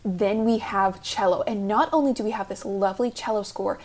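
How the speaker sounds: ordinary delivery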